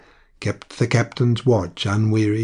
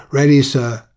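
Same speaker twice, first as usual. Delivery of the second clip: called up loudly, pitched higher; 4 semitones higher, 8 dB louder than usual. A narrator's reading. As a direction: call it up the companion stairs. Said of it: "called out".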